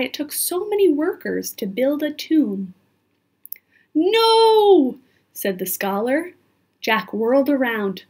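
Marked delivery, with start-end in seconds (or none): 3.9-5.0 high, loud, drawn-out cry of alarm. A narrator's reading. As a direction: cry out in dismay as the story's scholar. Scholar cries out in alarm.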